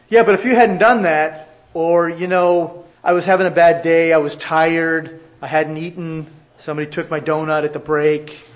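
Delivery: loud, defensive excuse voice; whiny excuse-making. Retold or imitated. A sinner making excuses instead of apologizing, whining loudly. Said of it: "So much different than".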